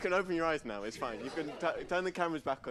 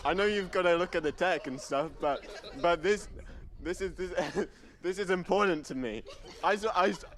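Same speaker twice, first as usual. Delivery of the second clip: loud, higher, half-laughing mock scolding; mock anger; 6 semitones higher, 4 dB louder than usual. Host mock-scolding the audience for peeking, loud half-laughing telling-off. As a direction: playfully tell off the crowd, hammed-up. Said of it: "I'm getting angry".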